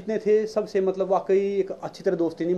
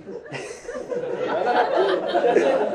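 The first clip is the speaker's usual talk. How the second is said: high burst of helpless laughter; laughing fit; 13 semitones higher than usual. Real, not acted. Singer breaking off the requested song, laughing. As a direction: crack up mid-song. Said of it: "हंसी आ रही है".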